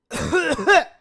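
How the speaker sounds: loud, visceral retching cough; violent fake cough. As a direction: cough hard from the stomach, intensely. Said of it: "it's gotta sound visceral, intense".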